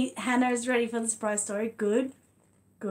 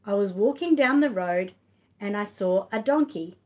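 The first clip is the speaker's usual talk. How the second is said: big, louder read-aloud voice, stretched slowly; reading to children; 7 dB louder than usual. Acted. Reading The Wonky Donkey to her son and viewers, lively read-aloud. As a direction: tell it like a lively picture book for little ones. Reads picture book aloud.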